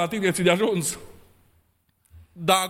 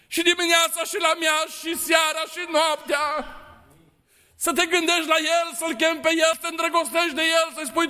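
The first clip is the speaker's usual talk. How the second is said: impassioned fast sermon; fervent preaching; 6 semitones higher than usual. Real, not acted. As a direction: drive the call home with fire. Preaching at full force.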